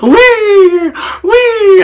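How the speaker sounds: imitating a joyful ride squeal, very high and loud; uncle's wee. Uncle on the ride beside her, gleeful high squeals. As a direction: squeal it like a thrilled kid on a drop. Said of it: "He's like".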